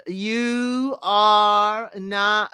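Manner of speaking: mock slow, every word stretched; dragged-out pause. Mocking Lauren's slow elimination words, dragged out. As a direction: drag it out like a stalling host.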